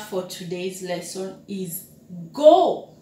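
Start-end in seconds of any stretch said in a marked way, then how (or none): 2.3-3.0 sending someone off. Shown as a demonstration. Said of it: "like you are releasing someone to go".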